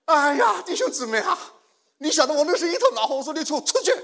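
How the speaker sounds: high, furious full-voiced shout; fierce retold outburst. Preacher orders the uncle out of the room, fiercely. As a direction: explode with temper, roaring the order to leave. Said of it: "大概我这人凶起来蛮可怕的".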